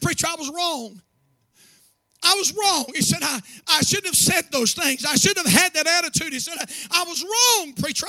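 high, loud, impassioned climax; preaching at full force. Acted. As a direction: pour the emotion over the church.

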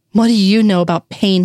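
a lightly spat bitter question; narrated mild hiss of anger at the priest. A narrator's reading. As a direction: spit the words out in restrained contempt. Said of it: "he spat".

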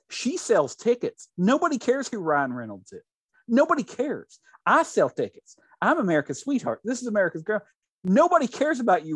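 high-pitched, emphatic retold scolding; mock berating. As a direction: act out an indignant put-down. Acted quarrel, berating Ryan Reynolds.

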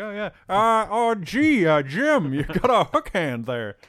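high, drawn-out comic impression; Eugene Levy. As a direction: stammer it out high, stretching the last words. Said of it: "it'll be like Eugene Levy has a cameo".

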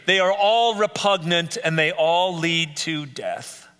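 slow, weighty, every word stressed; solemn warning. Real, not acted. Preacher warning the congregation, slow and solemn. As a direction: land each word like a verdict. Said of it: "but hear me".

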